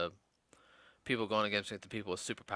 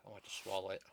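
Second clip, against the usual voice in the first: ordinary delivery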